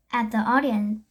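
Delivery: ordinary delivery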